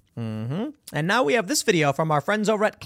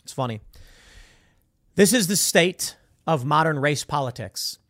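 ordinary delivery

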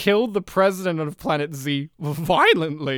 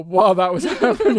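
normal talking voice

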